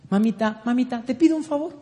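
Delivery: high, rising, quick request; young boy pleading. Retold or imitated. Son of eleven asking mother a favor, pleading. Retells his childhood plea to mother.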